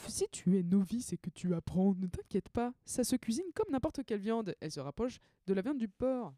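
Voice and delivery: lively mock cookbook reading; reading aloud. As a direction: read it out like a playful recipe. Reads her own guide aloud. (lecture enjouée d'une recette)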